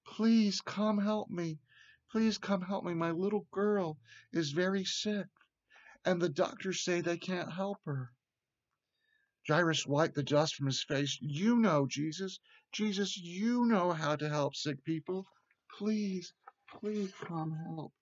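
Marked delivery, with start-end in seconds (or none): 0.1-8.1 a worried father's urgent appeal; pleading. A narrator's reading. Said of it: "he called out as he was near enough". 11.2-17.9 high, earnest pleading; desperate plea, lightly acted for children; begging. A narrator's reading. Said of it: "and he's begging".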